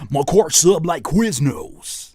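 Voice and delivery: slow, loud E-40 line; quoting E-40. Retold or imitated. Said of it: "He said that car sub".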